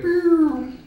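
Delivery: high, loud siren whoop; recorded cop message starting with a loud siren whoop; police siren. Pretend. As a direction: mimic a cop car siren. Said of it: "They'll be like".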